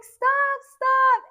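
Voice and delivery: high, loud cry to stop; urgent retold shout. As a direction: imitate her shouting to halt a fight. Michelle breaking up the fight.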